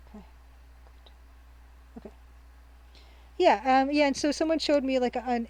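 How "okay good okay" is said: whisper. Real, not acted. Quiet aside after fixing iPad.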